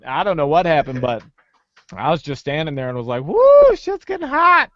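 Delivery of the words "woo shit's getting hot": high, loud woo, still high after; acting out a sudden hot flush; retold overheated whoop. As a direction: whoop it high, then ease slightly. Acts out moment before fainting.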